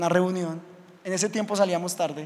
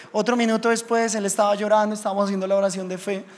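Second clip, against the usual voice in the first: usual speaking voice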